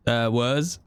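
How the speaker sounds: ordinary talk, nothing marked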